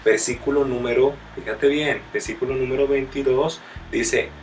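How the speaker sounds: loud, fervent sermon delivery; preaching at full force. Acted. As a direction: call out the verses to the congregation. Preaches the next verses loudly. (predicando con fuerza y fervor)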